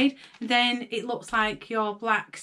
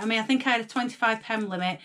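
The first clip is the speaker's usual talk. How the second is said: ordinary talk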